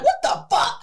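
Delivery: disbelieving shriek at the gate; high startled yell; shocked outburst. Retold or imitated. Parking ticket fails.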